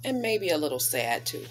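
expressive picture-book storytelling voice; reading to children. Acted. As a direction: read it like storytime on the rug.

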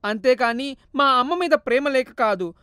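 son breaking down, mild acted tears; understated tearful remorse; regretful weeping. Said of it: "ఏడుస్తూ".

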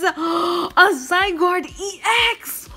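high, slow, loud astonished joy; thrilled gasp. Real, not acted. Pulling a Zygarde EX, thrilled gasp. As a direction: gasp and shout with delight.

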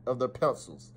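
ordinary delivery

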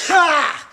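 loud sudden real sneeze; sharp high sneeze; big sneeze. Real, not acted. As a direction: let a full sneeze burst out. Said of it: "It's a good sneeze".

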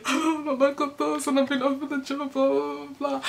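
high-pitched mock wail; imitated crying. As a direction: whine it high, as if bursting into tears. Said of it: "I just burst out crying".

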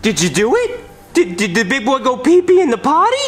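put-on high, sing-song voice for a toddler; baby talk. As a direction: praise him like a potty-training toddler. Doctor praises patient like toddler.

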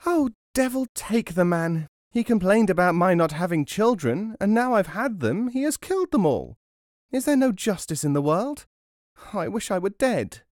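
high-pitched, indignant wife; cross. A narrator's reading. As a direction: rail crossly about your husband. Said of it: "said to herself crossly".

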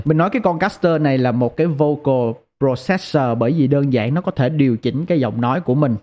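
ordinary talk, nothing marked

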